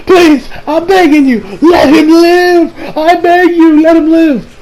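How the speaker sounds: high, wailing mock plea; desperate begging. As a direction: beg for his life, drawn out and hammed-up. Acted pleading for a life.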